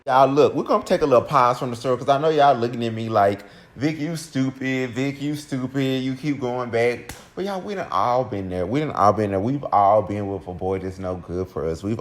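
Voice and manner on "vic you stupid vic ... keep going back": slow, higher sing-song chiding; mocking scolding. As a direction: imitate viewers dragging out a scolding. Imitates viewers scolding her.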